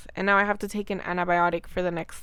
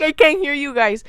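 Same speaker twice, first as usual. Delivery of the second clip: high, loud panicked cry of not hearing; panicked yell; 6 semitones higher, 9 dB louder than usual. Retold or imitated. Patient panicking at the nurses, loud high yell. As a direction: shout it in a panic, straining to hear. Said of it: "I started panicking".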